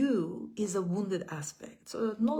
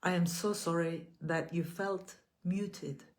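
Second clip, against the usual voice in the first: measured, gentle affirmations with long pauses between. Acted. Leads repeat-after-me affirmations.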